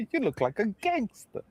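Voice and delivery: high, soft mock mom voice while laughing; laughing high imitation of the mom; mom quote again. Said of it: "Remember that?".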